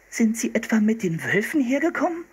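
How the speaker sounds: put-on high-pitched disbelieving girl; incredulous. A narrator's reading. Girl questioning the seal hunter about wolves, incredulous. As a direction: ask in high-voiced disbelief. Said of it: "fragte Anne ungläubig".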